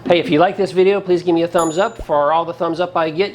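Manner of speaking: usual speaking voice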